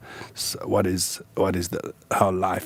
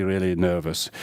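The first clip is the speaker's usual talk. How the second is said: ordinary talk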